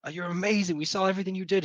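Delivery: high, loud, gushing thanks; a townsperson gushing with thanks; grateful crowd cheer. Acted. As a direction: cheer the hero on. Crowd praises the hero.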